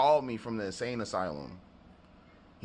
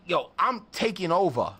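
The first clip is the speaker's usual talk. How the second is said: louder cocky mock brag; cocky boast; 6 semitones higher, 5 dB louder than usual. Cocky rapper telling the narrator he's taking over. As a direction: strut it like you own the place.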